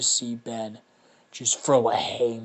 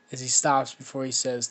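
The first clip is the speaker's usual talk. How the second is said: usual speaking voice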